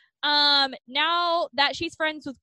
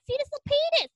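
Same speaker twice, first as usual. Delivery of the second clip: squeaky reply; 12 semitones higher, much faster than usual. Retold or imitated. Character answer in recap.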